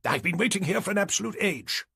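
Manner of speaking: high, impatient British character voice; clipped British accent. A narrator's reading. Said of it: "in a distinctly clipped British accent".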